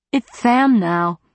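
lightly voiced adolescent boy, breaking voice. A narrator's reading. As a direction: insist on the new name while the voice cracks. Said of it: "Sammy's voice was breaking".

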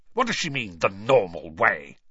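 indignant Uncle Vernon, stress on normal; spat out. A narrator's reading. Uncle scoffing at the letter's wording, spat out. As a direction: spit it out in disgust. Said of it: "he spat".

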